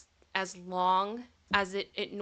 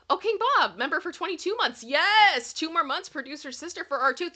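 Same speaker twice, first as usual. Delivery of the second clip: much higher and louder cheer; excited; 9 semitones higher, 6 dB louder than usual. Real, not acted. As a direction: celebrate the milestone out loud. Celebrating a long membership.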